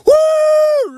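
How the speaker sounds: very high, loud celebratory woo; victory whoop. Retold or imitated. Ben celebrating with Fruity Pebbles like champagne, whooping. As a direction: whoop like a race winner.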